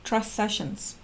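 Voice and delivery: "Trust Sessions" said very softly; quiet repeat. Shown as a demonstration. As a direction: hush "Trust Sessions" knowingly. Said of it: "repeated quietly".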